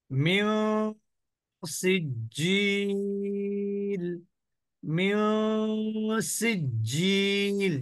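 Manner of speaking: মিউ with a held nasal, then slow সিজিল with a long "jii"; ghunnah demo. Shown as a demonstration. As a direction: hum out the end of মিউ, stretch the last vowel of সিজিল. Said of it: "অনিশ্বারের মতন".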